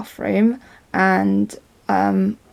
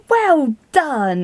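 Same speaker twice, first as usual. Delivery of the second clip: higher, drawn-out hearty congratulation; big loud voice; 7 semitones higher, 3 dB louder than usual. Retold or imitated. Passenger congratulating the steward as he walks past, big voice. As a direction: call it out proudly, as re-enacted praise. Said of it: "in a big loud voice".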